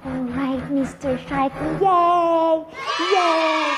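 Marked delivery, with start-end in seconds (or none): 1.8-3.7 high happy cheer. Pretend. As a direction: cheer loudly.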